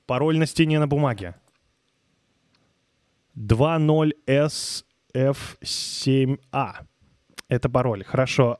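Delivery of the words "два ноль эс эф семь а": slow spelling out. Real, not acted. Reading password slowly.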